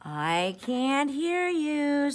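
very slow, high coaxing fox; sly fox. A narrator's reading. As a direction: stretch it out to lure him. Fox lures him closer.

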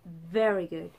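ordinary talk

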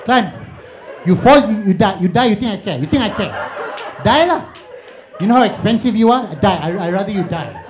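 high, loud exasperated scolding mother. Retold or imitated.

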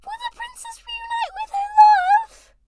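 very high put-on voice building to a shriek; squeaky falsetto. Acting a line in falsetto.

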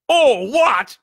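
very loud, high outraged shout; thundering. A narrator's reading. Giant echoes the boy's question, thundering. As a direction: thunder it back in disbelief. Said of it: "Hagrid thundered".

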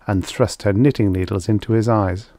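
plain reading voice

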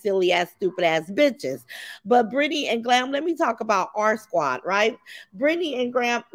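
usual speaking voice

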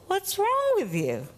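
lightly voiced little girl scolding the adult; child's retort. Four-year-old scolds the therapist with the song's advice, rising indignant. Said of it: "turns around to me and says".

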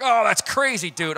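loud, high mock incredulity; imitated laughing disbelief. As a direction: play a friend scoffing it off. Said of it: "he's, like, laughing".

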